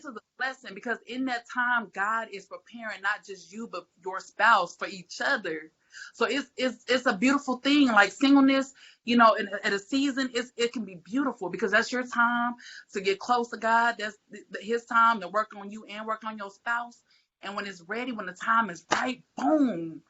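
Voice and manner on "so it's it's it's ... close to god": louder and higher, building into a sermon; rising church fervour; preaching. Real, not acted. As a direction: preach it to the congregation. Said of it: "I'm not gonna preach on today".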